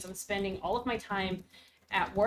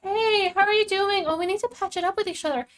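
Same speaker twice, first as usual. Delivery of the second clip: doting villain; 8 semitones higher, 8 dB louder than usual. Retold or imitated. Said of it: "And the villains are like".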